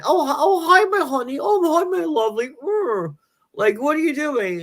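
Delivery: very high, syrupy greeting; fake-sweet husband. Husband walks in on his wife, very high syrupy coo. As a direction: coo at her with overdone sweetness. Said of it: "he walks in".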